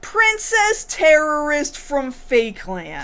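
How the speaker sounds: high, slow singsong proclamation; stretched mock-royal announcement; mock grand title. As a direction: proclaim the title with drawn-out pomp.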